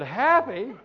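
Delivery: loud, high incredulous echo; mock disbelief. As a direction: throw the word back in disbelief. Retold disbelief at crying from happiness.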